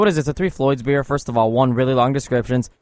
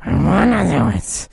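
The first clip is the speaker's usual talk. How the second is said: pit fiend; 5 semitones higher, 4 dB louder, slower than usual. Pretend. Demon voice game continues.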